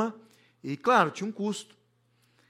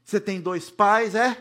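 louder, slow teasing shout; imitating a child shouting; 4 semitones higher, 6 dB louder than usual. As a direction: call it out like a cheeky son. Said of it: "o Pedro gritou assim".

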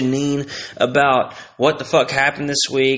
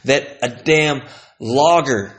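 ordinary talk, nothing marked

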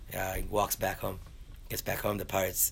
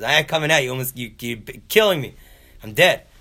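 high, loud acted protest; loud panicked refusal; 4 semitones higher, 10 dB louder than usual. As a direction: refuse through a closed door. Beaten man refuses to come out.

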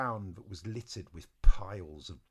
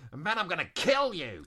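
louder, higher outburst; exasperated threat; 8 semitones higher, 5 dB louder than usual. Acted. Eliza threatens her troublesome friend, loud exasperation. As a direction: build to a punched threat.

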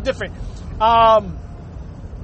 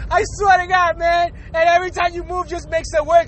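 ordinary talk, nothing marked

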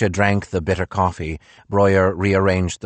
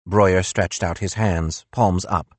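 plain reading voice

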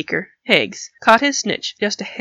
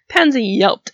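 plain reading voice

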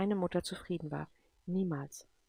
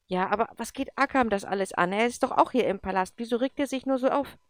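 higher at the end, a bit louder; a defiant boy not understanding; indignant protest; 5 semitones higher, 6 dB louder than usual. Acted. Andrew protests against John. (trotzig und empört)

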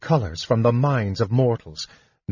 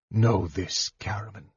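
icy, softly spoken warning; chill voice; 6 dB quieter than usual. A narrator's reading. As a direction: state it coldly, dropping away at the end. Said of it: "his voice was as chill as the dark waters".

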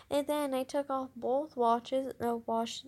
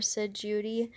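ordinary delivery

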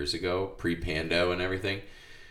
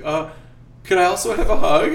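put-on higher, louder, fast boyish ask; student asking his teacher for a hug, higher; sweet kid voice; 12 semitones higher, 10 dB louder than usual. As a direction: ask sweetly like an eager boy.

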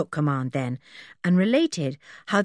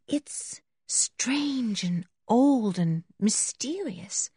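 hushed, slow wonder; half to herself; 4 semitones higher, 4 dB quieter, much slower than usual. A narrator's reading. Berta musing in the old castle, half to herself. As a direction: murmur it slowly under your breath. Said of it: "half to herself".

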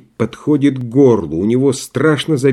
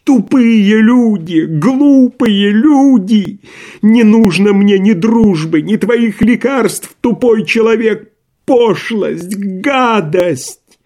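high, stretched-out enraged tirade; furious shouting; 10 semitones higher, 5 dB louder, much slower than usual. A narrator's reading. Doctor abusing his stupid visitors, shouting in rage. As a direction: hurl insults at the top of your voice. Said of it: "продолжал кричать Андрей Ефимович".

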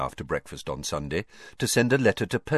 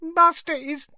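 put-on whimpering drunk elf. A narrator's reading. Winky whimpering for her master. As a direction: whimper it, near tears and tipsy. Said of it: "whimpered the elf".

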